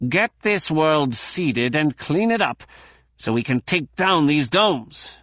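a touch of a growl at the microbiologist. A narrator's reading. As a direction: Plee growling at a colleague. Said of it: "Doctor Plee growled".

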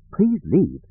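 put-on a sweet old nanny asking politely; sweet grandmotherly voice. A narrator's reading. A nanny droid politely asks intruders to leave, sweet grandmotherly voice. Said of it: "in a sweet grandmotherly voice".

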